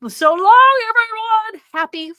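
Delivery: high, loud sing-song farewell; cheery goodbye. Real, not acted. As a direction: call out the goodbye brightly. Cheery sign-off to viewers.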